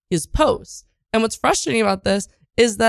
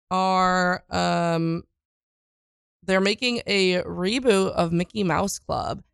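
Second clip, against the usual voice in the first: ordinary talk, nothing marked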